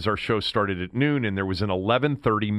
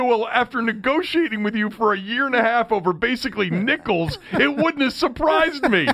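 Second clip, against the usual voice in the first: amused retort; 11 semitones higher, 3 dB louder than usual. Retold or imitated. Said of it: "And I said".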